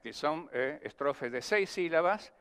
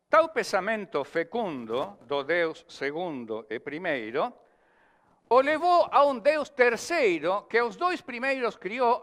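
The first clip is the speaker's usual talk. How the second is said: slow, louder recited verse; cordel recitation; 3 semitones higher, 4 dB louder than usual. Acted. Poet reciting his cordel to the class, declaimed. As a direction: declaim the stanza like a folk poet.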